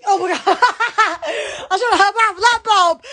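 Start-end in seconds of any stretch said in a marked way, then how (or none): 0.4-1.6 real cackling laugh, quick repeats; burst of laughter. Real, not acted. Host cracking up at her own show, laughing. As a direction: crack up on the spot.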